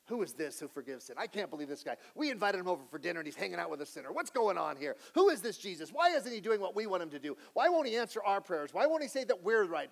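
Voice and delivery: mock outrage, rising steadily in pitch; indignant grumbling. Acts out indignant Pharisee guests complaining.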